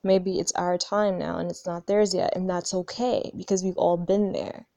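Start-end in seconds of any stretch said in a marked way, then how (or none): none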